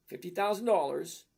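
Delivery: ordinary talk, nothing marked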